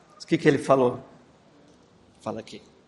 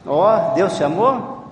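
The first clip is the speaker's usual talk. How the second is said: "Deus te amou" louder, drawn out, rising at AMOU; loud checking question; 2 semitones higher, 7 dB louder, much slower than usual. Real, not acted. Checking the child heard it through the phone, loud question.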